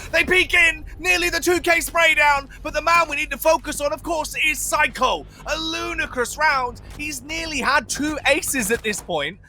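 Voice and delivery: high, driving live call; esports caster. Acted. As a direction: build the hype on every kill.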